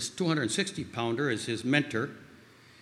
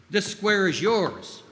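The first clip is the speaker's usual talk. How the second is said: raised, slow, emphatic order; imitated yell; 6 semitones higher, 5 dB louder, slower than usual. Mentor assigning player his square, loud imitated order. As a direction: imitate a mentor laying down the rule. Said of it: "yelled in his distinctive accent".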